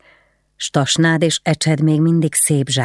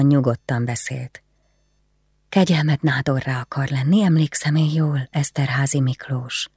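usual speaking voice

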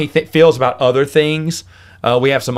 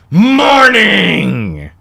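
loud, high catchphrase; catchphrase shout; 7 semitones higher, 4 dB louder than usual. Real, not acted. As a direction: shout it like a signature line.

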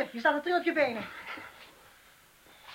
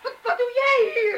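high, shaky startled cry; woman startled by a stranger at night; startled fright; 10 semitones higher than usual. Acted. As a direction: gasp it out in shock.

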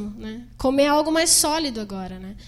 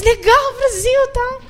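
cheerful fans; 9 semitones higher, 5 dB louder, slower than usual. Retold or imitated. Fans cheering after autographs.

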